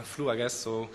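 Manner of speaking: ordinary talk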